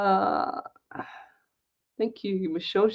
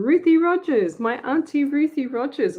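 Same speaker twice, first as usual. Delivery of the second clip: higher, louder, delighted call of the name; light up at seeing family; excited greeting; 7 semitones higher, 3 dB louder than usual. Real, not acted. Niece greets her aunt who joined the stream, excited.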